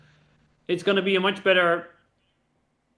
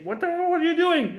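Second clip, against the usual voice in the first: higher, exasperated mock outburst; 9 semitones higher than usual. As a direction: throw it out like a fed-up partner. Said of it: "if I say".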